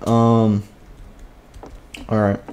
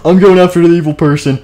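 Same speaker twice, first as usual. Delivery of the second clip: loud, higher, play-acted guard; mock-heroic outburst; eager declaration; 6 semitones higher, 6 dB louder than usual. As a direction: jump in loudly to claim the job. Guard volunteers in acted squabble.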